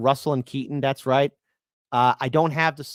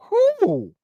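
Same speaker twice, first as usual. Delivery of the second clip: whew; 5 dB louder than usual. Real, not acted. Reacting to the rant.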